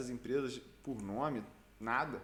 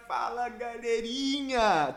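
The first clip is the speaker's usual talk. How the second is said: slow, high, drawn-out hello; excited stretched greeting; 8 semitones higher, 8 dB louder, slower than usual. Real, not acted.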